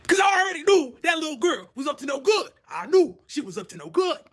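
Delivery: high, emphatic knowing protest; worked-up insistence. Retold or imitated. Storyteller insists the little girl was scheming, high worked-up insistence.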